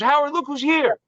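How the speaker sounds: high-pitched announcing call; retold excited call-out. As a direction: imitate showing off a surprise guest. Don calls out to Howard.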